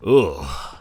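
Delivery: loud groan of disgust. Acted. Mortal ex-immortal groans at their own feelings, disgusted.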